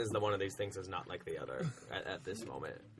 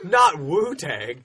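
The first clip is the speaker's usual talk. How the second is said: mock anguish; 5 semitones higher, 14 dB louder, much slower than usual. Real, not acted. Dismay at banned word.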